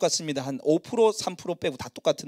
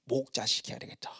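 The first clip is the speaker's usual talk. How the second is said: inner voice; 6 semitones lower, 9 dB quieter than usual. Retold or imitated. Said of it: "하나님 제 마음에".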